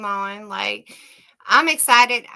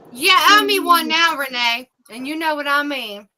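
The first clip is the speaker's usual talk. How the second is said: normal talking voice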